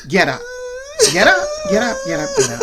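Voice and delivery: high, insistent get up; impatient, high-pitched coaxing; urging. A narrator's reading. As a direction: chivvy her to her feet, pitched high. Stephanie urging Nicole up.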